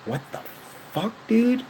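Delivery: sudden high squeak of shock; shocked outburst. Retold or imitated. Shocked seeing stranger on stream.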